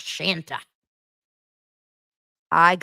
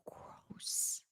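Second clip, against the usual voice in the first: breathy whispered disgust; disgusted aside whisper; whispered gross; 21 dB quieter than usual. Real, not acted. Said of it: "disgusted reaction".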